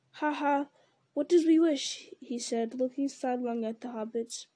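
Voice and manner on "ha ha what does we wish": higher, louder teasing question; gleeful taunt. A narrator's reading. Gollum teasing the hobbits mid-song, gleeful raised voice. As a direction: exclaim it with lightly acted glee.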